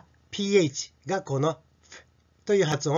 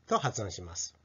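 ordinary delivery

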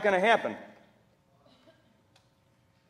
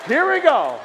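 high, loud groan of "Here we go"; exasperated; 9 semitones higher, 7 dB louder than usual. Retold or imitated. Preacher groaning at a stranger's question, high and loud. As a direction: brace for the sermon.

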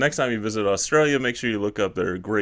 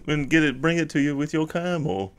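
lightly hammed-up Aussie bloke, higher and louder; a playful hint of an Australian camel farmer; the camel speaks like a jokey Aussie; mock Australian accent; 6 semitones higher, 4 dB louder than usual. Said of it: "He's like".

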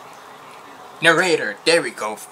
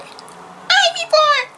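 very high, drawn-out mock squeal; high voice imitation; 5 dB louder, much slower than usual. Reacting to a singer's surprising voice, high-pitched imitation. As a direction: squeak it out slowly, far above your own voice. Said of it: "never heard your voice going".

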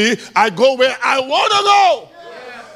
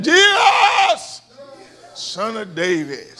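shouted high name, then a drawn-out plea; beggar's cry; 3 semitones higher, slower than usual. A narrator's reading. Blind beggar crying for mercy as Jesus passes. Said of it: "he made up some noise".

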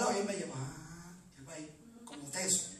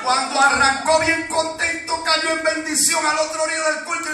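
loud, high shouted exhortation; fervent revival preacher shouting for praise; full-force preaching; 7 semitones higher, 19 dB louder than usual. Real, not acted. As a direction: rouse the congregation at the top of your voice.

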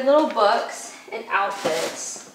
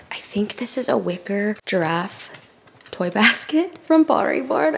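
ordinary delivery

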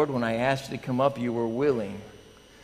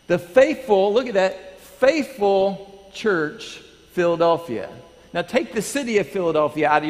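louder, higher, stretched faithful; emphatic preaching; 6 semitones higher, 5 dB louder than usual. Acted. Pastor proclaiming the faithful church, emphatic.

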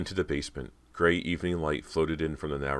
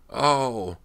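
loud, high groan on impact; winded cry of pain; 9 semitones higher, 6 dB louder than usual. A narrator's reading. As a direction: groan out as if thrown to the ground. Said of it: "she let out a groan".

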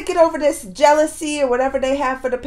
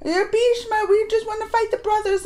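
higher, earnest mock pleading; pleading Pandavas; 10 semitones higher than usual. Pandavas to Bhishma, pleading not to fight him. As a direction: beg him not to make you fight. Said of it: "And they're all".

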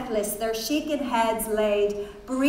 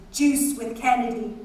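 ordinary delivery

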